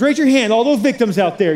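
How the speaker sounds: rally the crowd to raise hands; calling out. Real, not acted. Pastor calls to the congregation's youngest siblings, loud rallying call.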